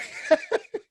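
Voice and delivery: high burst of laughter. Real, not acted. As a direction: laugh out at the punchline. End of funny story.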